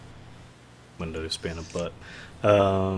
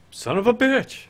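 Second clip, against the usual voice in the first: very high-pitched exasperated curse; comic frustration; 14 semitones higher, 4 dB louder than usual. Pretend. Game master after losing the page, high exasperated curse. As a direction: let the curse leap up high.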